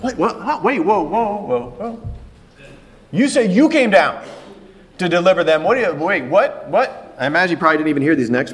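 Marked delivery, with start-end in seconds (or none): 0.0-7.2 laughing, startled stammer of disbelief; flustered Moses. Retold or imitated. Moses stunned by God's call, stammering in disbelief. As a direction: sputter like a man caught off guard. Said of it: "I can picture Moses like".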